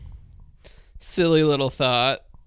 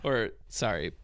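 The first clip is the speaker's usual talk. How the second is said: ordinary delivery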